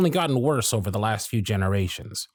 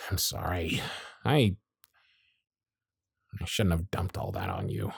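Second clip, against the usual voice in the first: softer, dropped low and quiet, a little embarrassed; sincere apology; 5 semitones lower, 6 dB quieter than usual. Acted. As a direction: pull back suddenly, genuinely sorry. Apologizes after venting.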